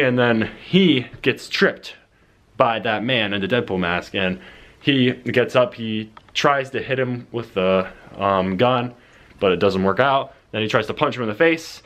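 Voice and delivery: ordinary talk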